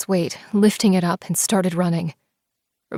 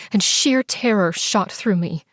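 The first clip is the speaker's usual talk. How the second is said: usual speaking voice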